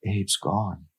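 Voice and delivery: hushed, grieving mutter; almost soundless mutter. Acted. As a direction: let it slip out in shock. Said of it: "Emery muttered, almost soundlessly".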